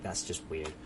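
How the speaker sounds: ordinary delivery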